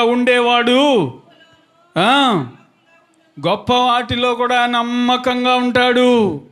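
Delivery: high, ringing sermon delivery on a held note; preaching. Real, not acted. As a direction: preach it out, stretching phrase endings. Preaches to congregation.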